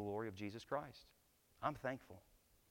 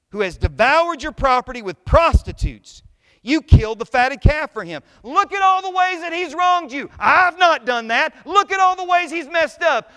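shouting, much higher and louder, full of grievance; indignant older brother; 14 semitones higher, 20 dB louder than usual. Acted. Older brother complaining to his father, indignant shout. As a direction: rant like the resentful older brother. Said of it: "the older brother is full of righteous indignation".

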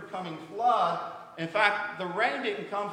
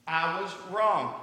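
drawn out and a bit louder; slow, firmer admission; 4 dB louder, slower than usual. Retold or imitated. Husband repeating his apology to his wife, slowly and clearly. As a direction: say it out so she hears it. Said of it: "Can you say it louder?".

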